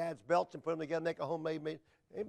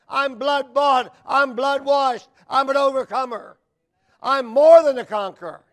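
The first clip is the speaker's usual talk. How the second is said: loud, higher preacher's cry; full-force preaching; 6 semitones higher, 13 dB louder than usual. Real, not acted. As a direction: drive each short declaration home hard.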